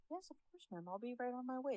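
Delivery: high, sugary-polite worker voice; fake customer service. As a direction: put on fake helpfulness for a customer. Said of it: "Like the little fake bitch that I am".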